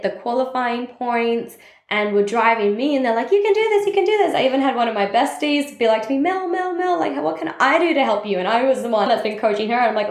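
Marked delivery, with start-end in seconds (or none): 3.3-4.3 high, eager cheering; cheering friends. Retold or imitated. Builders cheering Mel on, high and eager. 6.2-8.4 high, eager pleading; worried bestie. Retold or imitated. Bestie begging Mel to let her help, high and pleading.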